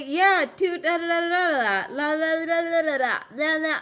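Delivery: loud, high mocking la-la filler; mock instruction rattle. Streamer mimicking the instructions she never got, mocking.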